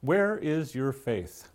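slow, soft and tender question; gently, lovingly ask it. A narrator's reading. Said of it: "he says lovingly".

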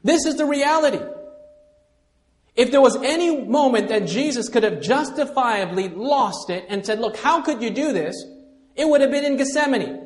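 high, loud climactic preaching. Acted. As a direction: drive it home to the congregation. Preaching at full force.

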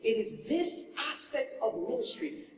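ordinary delivery

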